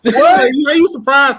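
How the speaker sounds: teasing a friend with exaggerated surprise; mock surprise. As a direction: blast it in fake astonishment.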